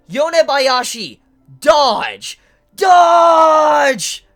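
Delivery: loud, rising to a full shout; shouted warning. Retold or imitated. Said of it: "he says".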